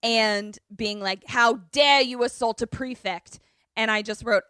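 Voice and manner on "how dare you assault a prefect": louder, indignant scolding; hammed-up indignation; mock outrage. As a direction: act affronted and hit it hard. Voicing prefect's outrage during scuffle.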